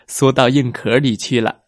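plain reading voice

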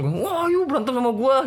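high, drawn-out dare to fight; hammed-up angry challenge; imitated fed-up challenge. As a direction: mimic a worked-up boy squaring up. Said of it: "Jadi kan kesel".

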